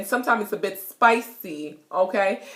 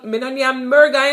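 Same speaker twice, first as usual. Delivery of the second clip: Jamaican patois; 4 semitones higher, 5 dB louder than usual. Shown as a demonstration. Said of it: "you could say".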